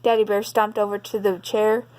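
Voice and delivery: ordinary delivery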